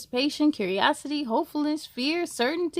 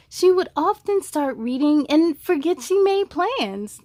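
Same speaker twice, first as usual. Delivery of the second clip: louder, rhythmic read-aloud voice; story narration; 3 semitones higher, 6 dB louder than usual. A narrator's reading. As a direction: read the rhymes to kids with bounce. Reads rhyming picture book.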